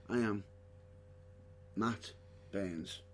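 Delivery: low, soft, pared-down introduction; toned-down name, dropping away softly; simple, understated. Acted. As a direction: say your name quietly with no flourish. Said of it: "Keep it simple".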